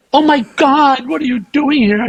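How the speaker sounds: high-pitched retold astonishment; surprised greeting. Friend runs into him in the elevator, high surprised greeting.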